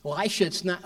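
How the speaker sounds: normal talking voice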